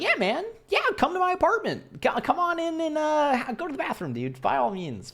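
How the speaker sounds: very high, overeager welcome; eager welcome. Retold or imitated. Inviting the stranger up to the apartment, very high and overeager. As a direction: gush the invitation like a giddy host.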